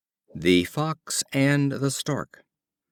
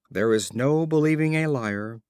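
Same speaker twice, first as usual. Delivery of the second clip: ordinary delivery, nothing marked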